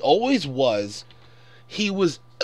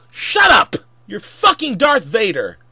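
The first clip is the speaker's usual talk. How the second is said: yelled much higher and louder; an exasperated fan yelling at the screen; angry shout; 5 semitones higher, 8 dB louder than usual. Acted. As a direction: scold him with a furious yell. Yelling back at Anakin.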